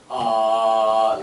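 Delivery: "ah" loud and long like at the dentist; open ah sound. Shown as a demonstration. Teacher showing her ah sound with an action. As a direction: open wide and say ah. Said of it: "accent and action is".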